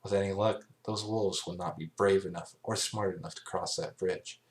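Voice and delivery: plain reading voice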